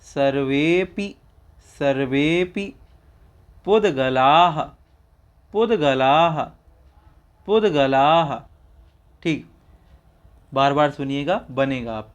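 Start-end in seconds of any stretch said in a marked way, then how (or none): 0.0-2.7 सर्वेपि said slowly and deliberately; slow recitation. Shown as a demonstration. Teacher reciting सर्वेपि for students. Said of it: "आगे है मया". 3.7-8.3 पुद्गलाः said with a voiced visarga breath at the end; clear recitation. Shown as a demonstration. Teacher modelling पुद्गलाः for students. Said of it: "आगे है मया".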